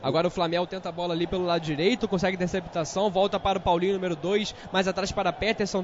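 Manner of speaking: usual speaking voice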